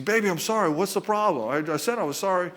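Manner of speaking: high-pitched mock apology, protesting; defensive husband. Husband insisting to wife he already apologized, defensive.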